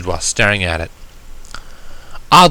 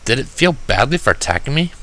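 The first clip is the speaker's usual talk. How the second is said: plain reading voice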